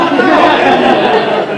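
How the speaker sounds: loud high laugh. Real, not acted. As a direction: laugh hard. Laughing after tease.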